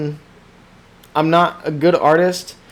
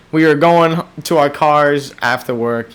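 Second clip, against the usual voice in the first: usual speaking voice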